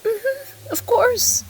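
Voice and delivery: tearful. Acted. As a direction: hold back tears as she answers. Wife recalling shared garden, tearful.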